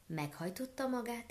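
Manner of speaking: ordinary delivery, nothing marked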